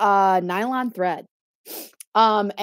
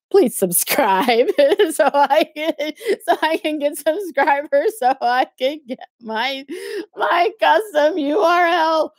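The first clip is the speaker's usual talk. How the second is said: higher, drawn-out fake-crying plea; mock tearful begging; 5 semitones higher, 3 dB louder than usual. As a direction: whine it out as a pretend sobbing beg.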